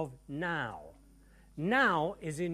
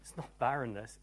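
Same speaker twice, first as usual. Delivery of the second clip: ordinary delivery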